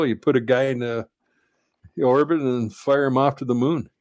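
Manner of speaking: normal talking voice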